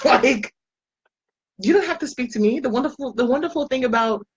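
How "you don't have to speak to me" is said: ordinary delivery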